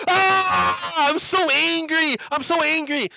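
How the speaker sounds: high, loud mock rage; a hammed-up fit of fake anger; mock angry outburst. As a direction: squeal it like a triggered troll. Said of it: "be like".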